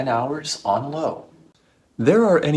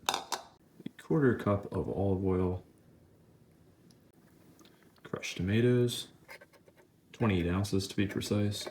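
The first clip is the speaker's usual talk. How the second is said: barely above a whisper, unhurried; hushed tones; 8 dB quieter than usual. Acted. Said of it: "speaking in hushed tones".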